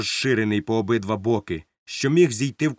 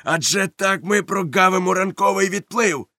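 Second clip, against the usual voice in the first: high, raised-pitch outcry; shouted protest; 7 semitones higher, 4 dB louder than usual. A narrator's reading. Long John protests about missing the tide, shouting. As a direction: protest loudly at the delay. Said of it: "закричав довготелесий".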